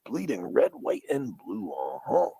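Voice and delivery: put-on growly wrestler shout, sinking as it goes; Macho Man. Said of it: "you can do Macho Man".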